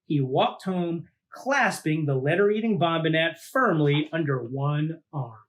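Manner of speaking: lively read-aloud storytelling voice; storybook narration. A narrator's reading. Host reading a monster picture book to kids, lively storytelling. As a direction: read it out like to a child at bedtime.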